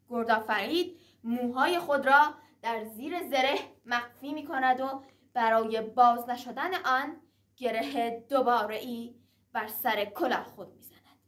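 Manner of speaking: ordinary delivery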